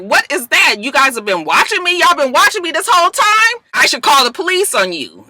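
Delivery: hammed-up angry accusation; mock outrage. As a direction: go off on them.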